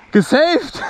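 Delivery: high excited shout; relieved cry. Real, not acted. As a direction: shout it out, thrilled. Relieved after near crash.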